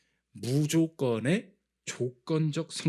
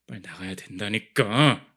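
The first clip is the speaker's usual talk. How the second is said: annoyed insistence; 6 semitones lower, 3 dB louder, slower than usual. Retold or imitated. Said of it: "제가 짜증나는 표정을 할 때는 언제냐".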